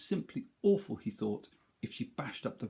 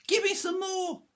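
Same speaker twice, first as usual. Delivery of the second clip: very high, louder demanding crone; greedy crone cry; 5 dB louder than usual. Acted. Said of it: "she cried".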